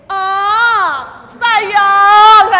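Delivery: put-on high pitying coo over the child. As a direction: feel sorry for her out loud. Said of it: "Pastor Tony said".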